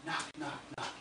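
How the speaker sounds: very soft, near-whispered knocking; hushed imitation of knocking; gentle knock. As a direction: tap out the words under your breath. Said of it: "a gentle knock on the door".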